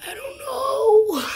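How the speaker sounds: high, slow strained squeak; forced voice. Shown as a demonstration. Gamer testing if his hoarse voice still works, forcing a high strain. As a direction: push the sore voice up and out. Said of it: "Should I force it?".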